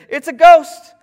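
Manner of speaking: sudden loud, high-pitched cry of alarm; acted burst of terror; terrified cry. A narrator's reading. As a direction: shout it out as if seeing a ghost. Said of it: "they were terrified and said".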